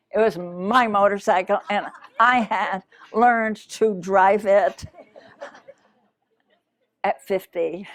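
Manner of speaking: laughing at yourself as you admit it; amused, laughing. Real, not acted. Speaker confessing a late-learned motorcycle skill to the audience, laughing. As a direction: tell it through laughter, punched up loud.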